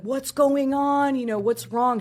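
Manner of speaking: high, loud, drawn-out panicked questions; retold freak-out. Mom to her puking child in the trailer, freaking out. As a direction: imitate a mother freaking out. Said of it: "She's freaking out".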